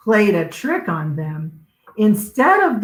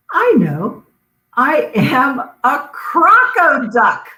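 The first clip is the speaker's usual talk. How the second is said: happy discovery; 10 semitones higher, 4 dB louder than usual. Acted.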